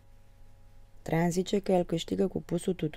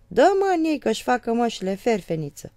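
louder and higher, urging him; shouting; 7 semitones higher, 7 dB louder than usual. Retold or imitated. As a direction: shout at him to hit them. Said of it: "strig eu". (strigăt îndemnând)

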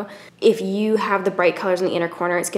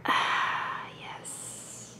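hushed satisfied whisper; relieved whisper; 5 semitones higher, much slower than usual. Pretend.

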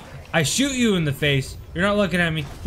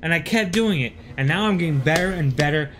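normal talking voice

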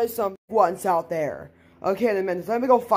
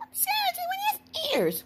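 very high, soft cartoon squeak; squeaky little character; 5 dB quieter than usual. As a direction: play a tiny squeaky-voiced friend. Voices a friend being woken.

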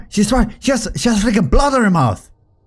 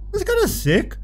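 high impressed squeak; 12 semitones higher, 3 dB quieter than usual. Real, not acted. Impressed by ice.

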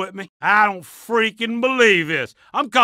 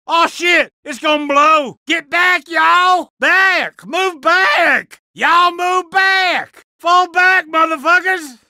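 urgent shouting; 6 semitones higher, slower than usual. Acted. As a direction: bark the retreat order. Bomb about to blow.

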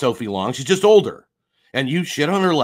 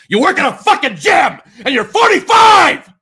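furious shout, much higher and louder; real rage shout, rising to a scream; losing it, yelling; 14 semitones higher, 9 dB louder than usual. Real, not acted. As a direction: blow up and scream it at him. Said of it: "All right, I'm losing it".

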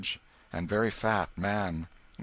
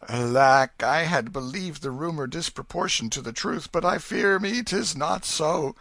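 louder, higher sorrowful king; father grieving his son's madness; deep disappointment; 8 semitones higher, 7 dB louder than usual. Acted. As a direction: let the hope drain out. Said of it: "in a tone of deep disappointment".

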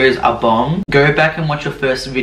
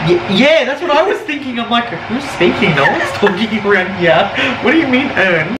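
a high, squeaky baffled voice; confused mock voice about the word urn; high mock confusion; 9 semitones higher than usual. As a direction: play it up as a confused character, higher.

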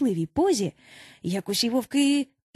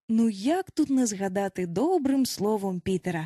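usual speaking voice

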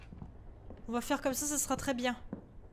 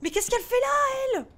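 much higher frightened cry; shrill panic; 11 semitones higher, 5 dB louder than usual. Real, not acted. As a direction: squeal it out in fright. Le fantôme réapparaît. (cri paniqué très aigu)

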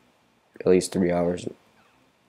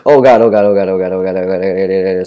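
terrified gibbering; 11 dB louder, faster than usual. Real, not acted. Panicking as enemies close in.

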